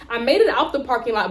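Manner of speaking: ordinary talk, nothing marked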